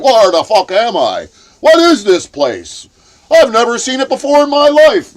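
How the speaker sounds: loud, rising bewildered cry; confused outburst. Retold or imitated. Pulled into a warehouse, shouting in confusion. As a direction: blurt it out, lost and alarmed.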